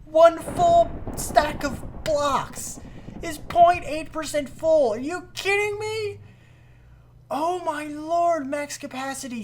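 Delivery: high, drawn-out incredulous outcry; freaking out. Real, not acted. Gamer seeing a full stack barely register, freaking out. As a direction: reel at the numbers in a high, stretched voice. Freaking out at tiny fill percentage.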